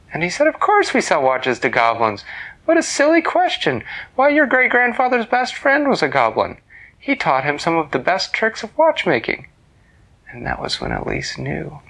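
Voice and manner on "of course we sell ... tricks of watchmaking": louder, hearty and amused; a dad laughing off a silly question; booming cheerful father; jovial father. Acted.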